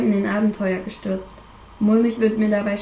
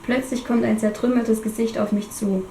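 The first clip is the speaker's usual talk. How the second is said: ordinary delivery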